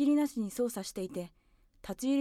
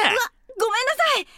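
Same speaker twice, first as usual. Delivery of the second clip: startled apology; 11 semitones higher, 9 dB louder, faster than usual. Acted. Boy startled, scolded by voice.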